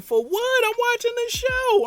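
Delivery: high, whiny brush-off; can't you see I'm busy; annoyed brother. Retold or imitated. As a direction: brother whining that he's watching the step show.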